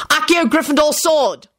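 high summoning cry; shouted spell. A narrator's reading. Lupin summons the sword, shouting the spell.

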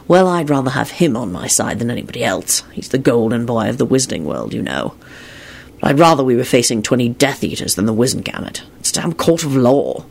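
low, soft, glum man's voice; subdued Lupin. A narrator's reading. Lupin worrying about the court, subdued. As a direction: voice him low and resigned.

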